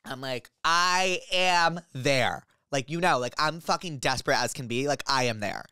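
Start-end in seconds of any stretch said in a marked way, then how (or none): 0.6-2.4 very slow, drawn-out declaration; emphatic, word-by-word eager reply; emphatic eagerness. Retold or imitated. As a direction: stretch each word out, a little higher.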